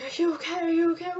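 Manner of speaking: high anxious asking; concerned neighbor. Retold or imitated. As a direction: sound like the neighbor rushing out worried. Neighbor voiced checking on her.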